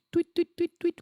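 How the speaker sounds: very high tweeting like a little bird; bird chirp imitation. Mocking a bird outside, very high tweets. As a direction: chirp it out like a songbird. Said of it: "that doesn't sound like a bird".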